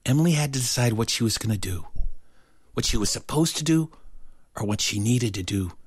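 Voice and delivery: ordinary delivery, nothing marked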